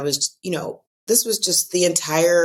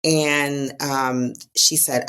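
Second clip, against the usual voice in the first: ordinary talk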